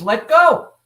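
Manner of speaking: put-on sudden high, loud child's demand. Child self pulling on the adult's hand, loud demand. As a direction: cry out like a child tugging free. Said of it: "it pulls on my hand".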